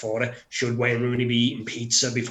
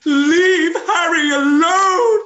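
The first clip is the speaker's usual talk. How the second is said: wailing crying plea, much higher and louder, very slow; hammed-up tearful plea; 11 dB louder, much slower than usual. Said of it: "I will end this with".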